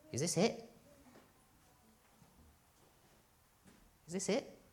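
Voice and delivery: soft, high, rising question; quiet disillusioned questioning. Acted. Champion alone with the thought running through his mind, quiet disillusionment. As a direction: ask it softly, lifting on "it". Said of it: "it kept running through his mind".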